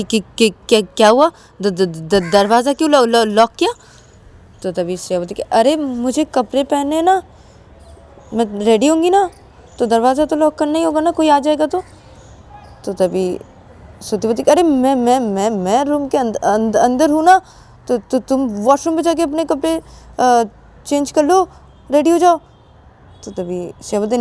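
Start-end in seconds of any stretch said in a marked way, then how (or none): 0.0-3.7 startled, stuttering question; acted panicky stammer; flustered stammer. Startled by the locked door. 5.4-11.8 higher-pitched, insistent explaining; acted insistence, pitched up; mild protest. Defends locking the door. 14.5-22.4 high, stammering objection; acted nervous stutter, pitched up; flustered protest. Flustered, objects to changing here.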